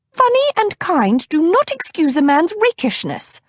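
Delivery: put-on high, prim older lady voice; disapproving fussy cousin. A narrator's reading. Narrator voices Cousin Batilda.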